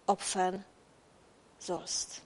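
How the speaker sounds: "opfern" sinks low and soft, "sollst" whispered; whispered ending of the sacrifice command; fading to a whisper. Acted. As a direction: let the dreadful word die away into a breath. God demanding the sacrifice.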